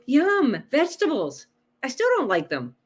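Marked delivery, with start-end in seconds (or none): 0.1-1.5 mock delight. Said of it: "I don't go".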